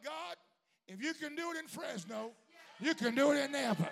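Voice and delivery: builds from speaking to a full high shout; preaching at full force. Real, not acted. Building prayer to climax.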